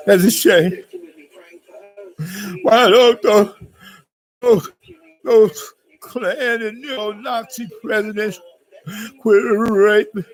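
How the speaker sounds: high, hammed-up weepy lament; mock tearful. Lamenting a shame to the audience, mock tearful. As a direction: wail the question through fake tears. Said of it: "it makes me wanna cry".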